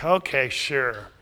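quietly condescending brush-off; arrogant. Acted. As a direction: grant it grudgingly, unimpressed. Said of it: "there's a tone of arrogance".

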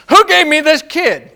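exasperated mock parent. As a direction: ham up a parent complaining. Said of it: "instead of saying".

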